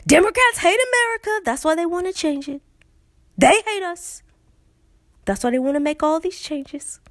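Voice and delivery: high-pitched hammed-up accusation; mock alarmism. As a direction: play an outraged, fearmongering politician, pitched high. Mimics GOP attack on Democrats.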